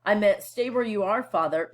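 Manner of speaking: ordinary talk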